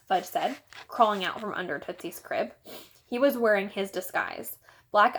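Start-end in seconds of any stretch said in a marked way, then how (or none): none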